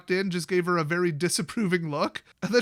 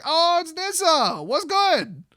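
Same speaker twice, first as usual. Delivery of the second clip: much higher and louder, slow and bright greeting; mock cheerful Jace; 10 semitones higher, 5 dB louder, slower than usual. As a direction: play Jace as an over-friendly pal.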